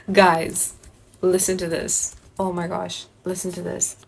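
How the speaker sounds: normal talking voice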